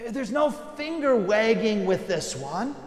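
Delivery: ordinary talk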